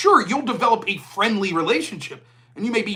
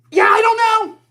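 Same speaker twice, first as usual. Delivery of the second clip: much higher, louder fed-up retort; exasperated snap; 10 dB louder than usual. Retold or imitated. Answering the same dinner question yet again, fed up and snapping. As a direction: blurt it like you've hit your limit.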